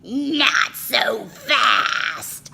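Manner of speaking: slow, loud, menacing witchy hiss; unpleasant hiss. A narrator's reading. Strict witch teacher stopping the kids, menacing hiss. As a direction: stop them cold with a sneer. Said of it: "hissed a very unpleasant voice".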